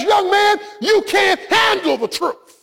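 a hammed-up Jack Nicholson, drawn out; Jack Nicholson impression. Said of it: "Jack Nicholson looks back as only he can and says".

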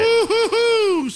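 high, long-drawn hooting woo-hoo; sarcastic whoop, held high and stretched; mock cheer. Real, not acted. Mocks the shirts' wordplay.

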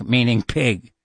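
usual speaking voice

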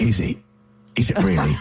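low-pitched put-on English accent; Jason Statham. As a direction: drop low into casual English. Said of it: "That's Jason Statham".

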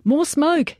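high-pitched shouted order; urgent yell. A narrator's reading. Soldier calling for cover for the exposed men, high yell. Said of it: "Jager yelled".

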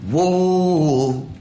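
a hint of a stage singer crooning Whoa into the mic; lightly sung showman's Whoa; singer's call. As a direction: call out Whoa to the crowd like a frontman. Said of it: "He went to the next person".

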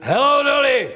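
high, projected show-title call; hearty called-out title. Acted. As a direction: sing out the title to the room. Calls out the song title.

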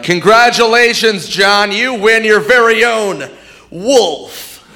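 high, slow announcer voice; game show host. Retold or imitated. Comic mocking host awarding a wolf. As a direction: announce it like a big prize. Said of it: "If they're like".

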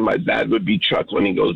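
normal talking voice

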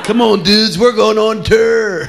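high, rousing call, "tour" stretched as TUR; hammed-up band rally with "tour" like fur; mockingly rally the band, dragging out "tour"; mock rock star. Mock rock star using wrong pronunciation.

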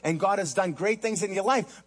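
ordinary talk

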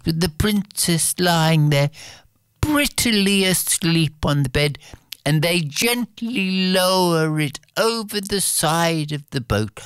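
usual speaking voice

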